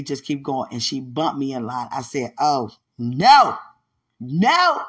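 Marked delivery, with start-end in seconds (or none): 2.4-4.8 indignant refusal. Retold or imitated. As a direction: belt out an outraged refusal. Retold reaction to being bumped.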